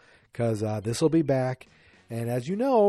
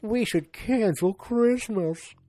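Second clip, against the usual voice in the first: higher, slow mocking whine; 8 semitones higher, slower than usual. Mocking the episode's plot, high and drawn out. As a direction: send up the sitcom drama. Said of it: "light sitcom problems of".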